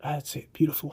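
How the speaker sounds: hushed, approving murmur; soft encouragement. Real, not acted. Photographer coaching the model mid-shot, hushed and approving. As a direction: praise very softly while concentrating on the shot.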